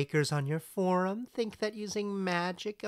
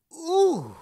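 gossipy ooh; 13 semitones higher, 8 dB louder than usual. Acted. Puck gossiping about aristocrats.

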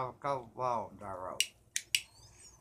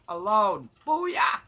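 loud, high-pitched booyah; sudden exuberant yell; triumphant shout; 7 semitones higher, 12 dB louder, much slower than usual. Real, not acted. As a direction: belt it out to cap the poem. Defiant end of poem, shouted cheer.

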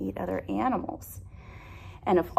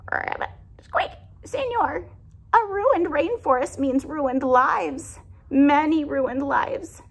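high, pleading animal voice, much higher; pleading forest animal; 9 semitones higher than usual. Acted. Animal begging the sleeping man, high and pleading. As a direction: beg the man to spare the tree.

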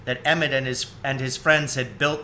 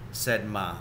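plain reading voice

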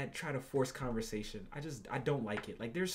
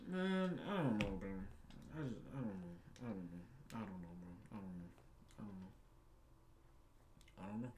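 resigned mumble; 6 semitones lower, 10 dB quieter than usual. Real, not acted.

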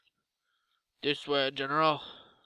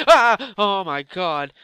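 sudden high, loud scream of fright; real jump scare, loud; startled yelp; 6 semitones higher, 20 dB louder, much slower than usual. Real, not acted. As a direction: jump out of your skin. Startled by skeleton.

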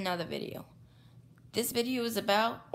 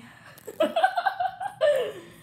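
high, loud laughing fit; burst of laughter; 5 dB louder than usual. Real, not acted. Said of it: "I can't stop laughing".

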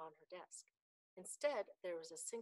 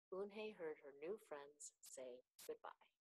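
ordinary delivery

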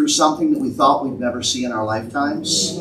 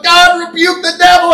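high, loud complaining plea to God; whiny pleading believer; 11 semitones higher, 3 dB louder than usual. Retold or imitated. Believers under locusts begging God, high whiny plea. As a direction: pitch it up like a fretful believer begging. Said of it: "they're going".

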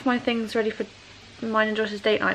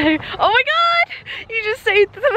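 surprised shriek; 11 semitones higher, 4 dB louder than usual. Real, not acted. Ball nearly hits new car.

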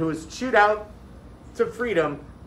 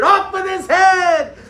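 much higher and louder, slow shout; 11 dB louder, slower than usual. A narrator's reading. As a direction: bellow it like a king's order. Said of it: "Sakaya shouted".

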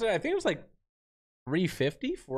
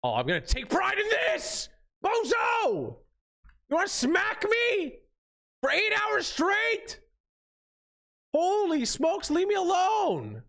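frustrated yelling; slower than usual. Real, not acted. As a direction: yell at the player hitting you. Getting smacked in game.

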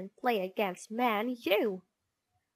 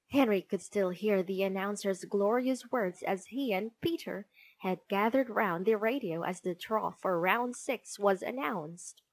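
usual speaking voice

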